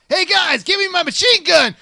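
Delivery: loud, high dumb hunter voice; trigger-happy hunter shout. Acted.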